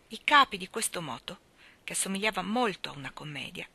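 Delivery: plain reading voice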